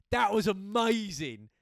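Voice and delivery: loud, slow, delighted shout of praise; excited praise. Retold or imitated. Best friend on the phone after the fight, loud and thrilled. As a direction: hype up your mate like he's a hero.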